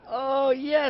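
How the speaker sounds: high, loud mock cheer of joy; excited cheer. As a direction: cheer like the fans just arrived. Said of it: "I'm like".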